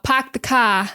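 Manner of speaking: "park the car" as "pahk the cah"; Boston accent. Shown as a demonstration. Teacher showing students the classic Boston phrase. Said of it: "big open mouth".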